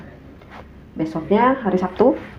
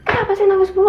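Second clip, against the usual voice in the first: annoyed snap; 10 semitones higher than usual. Retold or imitated. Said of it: "Apa gue bilang gitu kan".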